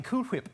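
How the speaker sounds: "Whip" said as hwip, H first; soft "Cool Whip" with a heavy H in "Whip"; exaggerated H. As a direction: puff an H before the w of "Whip". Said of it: "putting a lot of emphasis on the H".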